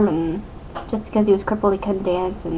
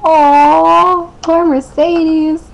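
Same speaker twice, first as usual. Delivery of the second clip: mock pity; 7 semitones higher, 5 dB louder, much slower than usual. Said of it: "And I was like".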